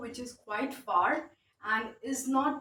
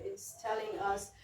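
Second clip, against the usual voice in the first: normal talking voice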